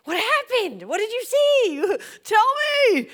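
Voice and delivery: high, loud, eager retold questions; eager questioning. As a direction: beg to hear what he saw. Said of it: "And I'm like".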